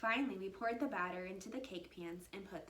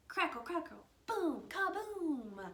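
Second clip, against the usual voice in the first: thunder sounds; 9 semitones higher, 6 dB louder than usual. A narrator's reading. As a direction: boom it out like thunder.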